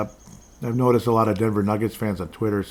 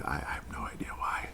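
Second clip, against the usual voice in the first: whispered aside, barely voiced; a quiet whispered shrug to himself; 6 semitones lower, 13 dB quieter than usual. Real, not acted. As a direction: drop to a breathy whisper, puzzled.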